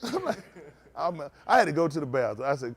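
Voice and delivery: usual speaking voice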